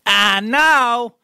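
imitating the lady. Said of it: "I'm guessing she sounded more like this".

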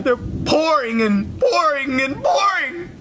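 slow, building melodramatic wail; tearful lament. Acted. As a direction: draw out each repeat, breaking into tears. Describing his tears.

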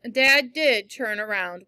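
ordinary delivery, nothing marked

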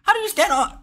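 can't believe what he's hearing; incredulous. Real, not acted. Streamer shocked by eleven hours.

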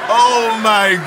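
long, drawn-out laughing exclamation; amused disbelief. Real, not acted. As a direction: stretch it out slowly, still tickled. Winding down from laughing.

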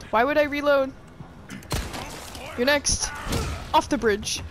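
loud taunting yell; gleeful battle shout. Pretend. Player kicking enemies off a bridge, loud taunting shout. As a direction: shout it at the enemy.

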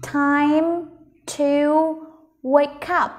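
"Time... to... wake up", a long pause after each word. Shown as a demonstration. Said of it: "Ok, mình cùng đọc nhé". (đọc rời từng từ "Time to wake up")

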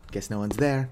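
ordinary talk

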